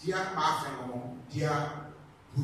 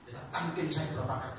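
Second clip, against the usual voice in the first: normal talking voice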